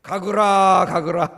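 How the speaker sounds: mock Gyeongsang pastor, loud and laughing; Gyeongsang accent. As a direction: imitate the pastor who can't say 가거라, in Gyeongsang dialect. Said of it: "가거라가 발음이 안 되더라고요". (경상도 사투리로 웃으며 크게 "가거라")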